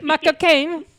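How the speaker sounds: mock Michael Caine. Friends mishear Michael Caine, hammed-up cockney. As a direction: ham up "cocaine" like Michael Caine. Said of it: "What did we think he was saying".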